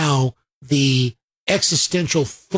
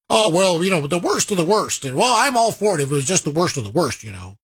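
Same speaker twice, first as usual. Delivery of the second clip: quick, offhand imitation of political opponents; mock insincere elite; faster than usual. Host mimics the party elite's excuses, mock insincere. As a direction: rattle it off as their empty excuse. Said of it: "those are just phrases that they'll say".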